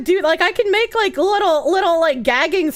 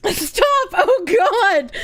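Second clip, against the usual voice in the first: laughing protest; 5 semitones higher, much slower than usual. Real, not acted.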